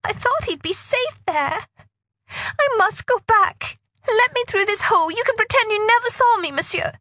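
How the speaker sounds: put-on high pleading girl voice. Girl begs to go back.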